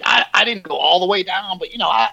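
high, boastful imitation of Vince; high mock Vince voice.

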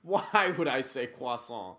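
loud, incredulous challenge; indignant. Real, not acted. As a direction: throw the question back loudly, offended. Indignant at pronunciation question.

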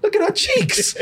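high, hammed-up gushing with laughter; mock cuteness overload. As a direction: squeal over an adorable child in jest. Acting escort melting over cute child.

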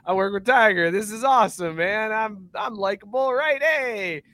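high, easygoing hype-man voice. Acted. Host voicing a pop star along for the ride, high mock-cool. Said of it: "And then [name] is just".

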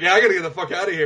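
fast, hurried getaway line; quick half-laughing escape; rushed mock panic. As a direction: blurt it out fleeing. Heroine fleeing the creep.

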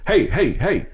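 calling out. Retold or imitated. Clerk calls out to returning customer.

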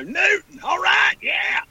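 very high whooping country holler; high-pitched, hammed-up yell. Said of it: "hollering out the window".

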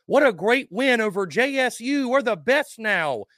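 high, sing-out fake enthusiasm; hammed-up rah-rah optimism, pitched high; mock cheerleading. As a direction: gush like a deluded fan, mockingly. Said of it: "I would look like a damn fool".

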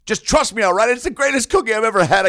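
excited gush. Acted. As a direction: rave about the cookie, half-joking. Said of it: "I get emotional thinking about it".

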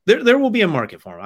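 loud, high, insistent; emphatic. Real, not acted. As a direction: belt it out with conviction. Emphatic repeat of his point.

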